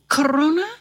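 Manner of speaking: ordinary delivery, nothing marked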